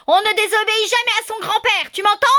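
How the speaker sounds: loud, high bellow of a wolf scolding; big booming voice. A narrator's reading. Lucas the wolf scolding the boy Pierre, loud bellow. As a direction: roar it out in your biggest voice. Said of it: "hurle Lucas de sa plus grosse voix".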